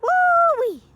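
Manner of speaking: high, loud thrilled whoop; excited whoop. Real, not acted. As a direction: let out a gleeful holler. Gust hits, excited whoop.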